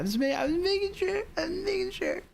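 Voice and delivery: very high-pitched, squeaky excuse; comic falsetto defensiveness; squeaky protest. Real, not acted. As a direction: jump way up high to plead innocence. Defends himself over the nickname.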